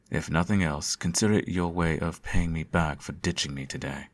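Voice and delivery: normal talking voice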